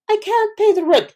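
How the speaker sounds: high pleading victim voice; damsel in distress. Retold or imitated. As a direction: squeal it like a helpless heroine. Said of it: "vaudeville plays where you're like".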